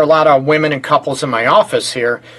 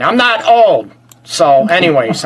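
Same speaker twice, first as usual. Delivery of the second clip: usual speaking voice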